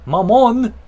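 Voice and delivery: Maman called out loud and high in mock French. Shown as a demonstration. Streamer calling for his mother in French, shouted. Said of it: "That's how you say mother".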